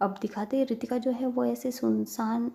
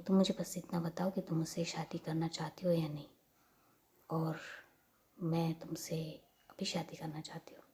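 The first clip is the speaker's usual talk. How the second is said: soft, lower whispered plea; hushed plea; 4 semitones lower, 6 dB quieter than usual. Retold or imitated. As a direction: whisper it close and urgent. Intimate plea for marriage. (धीमी फुसफुसाती विनती)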